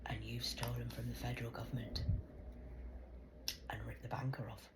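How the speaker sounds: soft, breathy accusing whisper. Real, not acted. Woman whispering accusations at the government. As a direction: hiss the accusation low.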